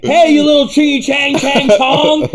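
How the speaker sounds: high, loud jeering movie taunt; mocking bigoted outburst. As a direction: yell it as a hammed-up heckle. Mimicking Eastwood's racist film outburst.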